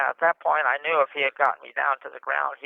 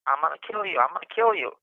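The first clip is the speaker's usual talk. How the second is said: usual speaking voice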